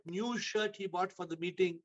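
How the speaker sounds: ordinary talk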